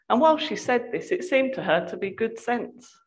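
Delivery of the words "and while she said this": ordinary delivery, nothing marked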